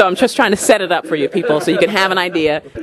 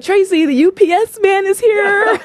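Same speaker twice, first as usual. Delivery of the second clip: receptionist; 12 semitones higher than usual. Retold or imitated. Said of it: "she'd be like".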